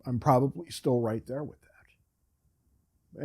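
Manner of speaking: whisper. Real, not acted. Whispered aside to listeners.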